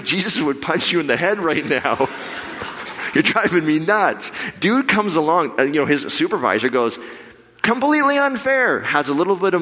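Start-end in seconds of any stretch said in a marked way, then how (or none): 0.0-4.3 high, hammed-up exasperation; mock angry. Angry joke about punching clerk. 7.6-8.8 high, indignant outburst. Retold or imitated. Supervisor voicing indignation.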